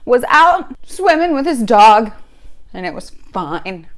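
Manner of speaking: normal talking voice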